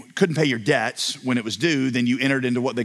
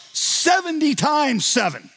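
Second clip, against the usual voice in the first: "seventy times seven" said slowly and much higher; emphatic answer; 12 semitones higher, slower than usual. Retold or imitated. Jesus answering Peter, slow and emphatic.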